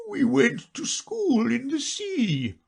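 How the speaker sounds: high, slow, tearful turtle voice; a weepy creature fighting back sobs; a touch of sobbing, high and slow; sobbing a little. Acted. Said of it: "though still sobbing a little".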